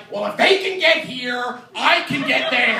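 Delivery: high, loud mock retort; defiant brother. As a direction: imitate an indignant boy arguing back. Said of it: "And he'd be like".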